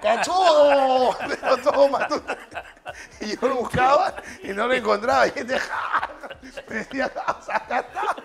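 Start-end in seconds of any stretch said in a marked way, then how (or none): 0.0-1.2 high, loud, very slow stretched jeer; heckling shout. Retold or imitated. Prankster hidden on the ground at night, heckling shout. 7.0-8.2 very high, slow, laughing imitation; mock high call. Telling how the hidden heckler was found, mock high call.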